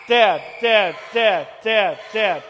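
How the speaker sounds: put-on loud, nagging kid demanding; whiny kid. Pastor voices his kids pestering, loud whiny nagging. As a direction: pester dad over and over. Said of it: "someone pulling on your leg saying".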